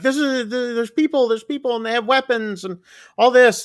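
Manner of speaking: high-pitched frightened rush; imitating a scared girl blurting it out. Wen telling her dads about armed strangers, high and frightened. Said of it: "is getting scared".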